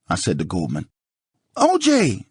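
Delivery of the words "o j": high, loud yelled name; hollering. Acted. Nicole hollering at O J to stop, high loud yell. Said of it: "Nicole hollered".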